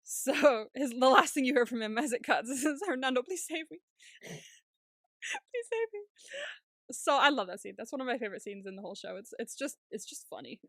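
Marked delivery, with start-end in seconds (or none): 2.9-6.0 high, helpless begging, stretched out slowly; whimpered mock plea. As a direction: beg pitifully, hammed up, getting higher. Last plea as connection cuts.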